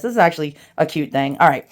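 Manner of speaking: ordinary talk, nothing marked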